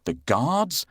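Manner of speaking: ordinary delivery, nothing marked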